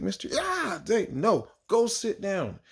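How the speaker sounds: a high, chirpy child impression; little kid voice. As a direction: pipe up like an eager kindergartner. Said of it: "They're like".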